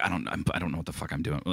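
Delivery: low, quick, soft mumble; neurotic self-doubt. Retold or imitated. Confessing being lost on set, neurotic mumble. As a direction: mutter it fast under your breath.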